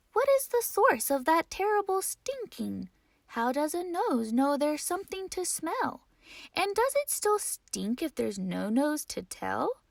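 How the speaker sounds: put-on high, puzzled little girl; curious child voice. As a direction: wonder aloud like a young scientist. Ada wondering about the stink.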